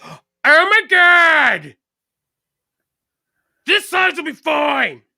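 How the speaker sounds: loud, high, drawn-out caricature of a sister; hammed-up high shouting skit voice; mock exasperated sister. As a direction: wail it like an over-the-top annoyed sibling. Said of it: "she's gonna, like, kinda talk like this".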